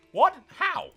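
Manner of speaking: high-pitched, stunned questions; incredulous outburst. Real, not acted. Player baffled by Truefire's rise, incredulous high outburst. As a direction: blurt it high, in utter disbelief. Disbelief at game event.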